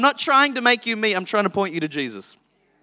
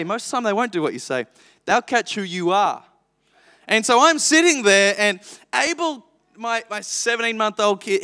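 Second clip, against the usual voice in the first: ordinary delivery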